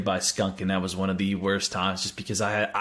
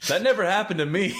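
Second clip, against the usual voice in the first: laughing through the words; giggling disbelief; 10 semitones higher than usual. Real, not acted. Laughing while answering friend.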